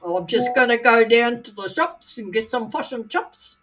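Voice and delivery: sing-song North Island Māori English; short, sharp words with a Māori lilt; North Island Māori accent. Shown as a demonstration. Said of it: "they sort of sing a little bit as well".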